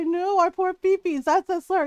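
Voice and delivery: high, loud mock wail; mock crying. Mimicking people who cry that the term is a slur, mock wailing. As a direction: whine it in mocking, exaggerated distress. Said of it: "they cry".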